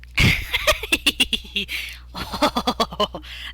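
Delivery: breathless laughing fit; long wheezing laugh. Real, not acted.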